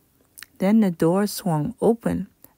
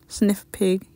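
usual speaking voice

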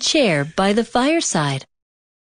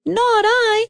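high, tiny mouse voice; squeaky mouse; 14 semitones higher than usual. A narrator's reading. Mouse refusing the hen, high and squeaky. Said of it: "said the mouse".

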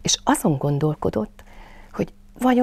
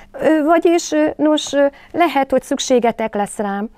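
bravely hesitant; 4 semitones higher, 4 dB louder than usual. A narrator's reading. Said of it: "tette hozzá bátran".

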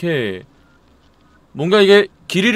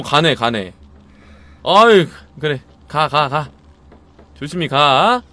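ordinary delivery

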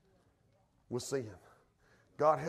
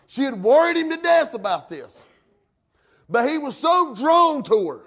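raised, loud pulpit voice; forceful preaching; 11 semitones higher, 11 dB louder than usual. Real, not acted. Preacher telling how she worried Samson, forceful preaching. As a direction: drive the story home like a preacher.

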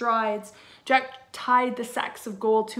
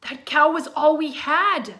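mother yelling, much higher and louder; furious shout; 6 semitones higher, 11 dB louder than usual. Acted. Mother shouting at Jack over the lost cow, angry yell. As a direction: shout it at her son in fury. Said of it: "she shouted".

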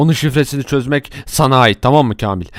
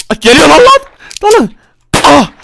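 very high, loud alarmed yell; shrieking charge order; panicked shriek; 13 semitones higher, 7 dB louder than usual. Acted. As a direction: scream a warning. Ninja charges at them.

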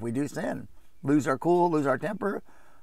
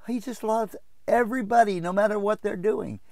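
high mock-sweeping assurance; feel-good believer; 9 semitones higher than usual. Preacher mimics those preaching love only, high mock voice. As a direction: parody easy grace, voice pitched way up.

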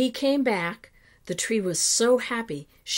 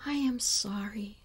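soft, breath-heavy apology; understated sorrowful sigh; 6 dB quieter than usual. A narrator's reading. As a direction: sigh it out with mild regret. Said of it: "sighed the tree".

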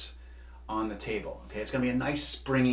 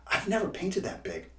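exclaimed; 6 semitones higher than usual. A narrator's reading. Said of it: "exclaimed Hugo".

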